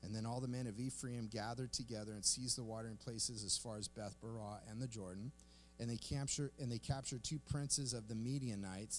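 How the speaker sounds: soft, low, measured read-aloud; scripture reading. Acted. Pastor reading scripture to the congregation, quiet measured reading. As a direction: read the passage quietly and evenly.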